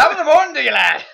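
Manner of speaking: high, shouted mock Irish greeting; hammed-up Irish shout; Jacksepticeye. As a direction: bellow it like an Irish YouTuber's intro. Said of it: "Jacksepticeye here".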